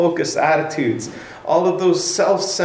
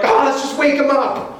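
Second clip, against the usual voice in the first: high, hurried mock panic; frazzled high-pitched outburst; freaking-out disciples; 11 semitones higher than usual. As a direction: play up the panic at the end of your rope. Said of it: "the disciples are freaking out".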